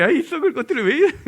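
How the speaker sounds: loud, high Jeolla grandma scolding fondly; 전라도 할머니의 거친 반가움. Retold or imitated. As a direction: holler it like a rough Jeolla grandmother. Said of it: "이 전라도 할머니들이".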